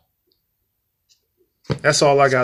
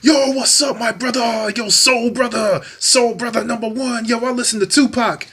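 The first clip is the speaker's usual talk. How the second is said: higher, hyped-up mock greeting; mocking Dutch fan; 7 semitones higher than usual. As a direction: imitate an overeager stranger trying to sound cool. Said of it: "He's like".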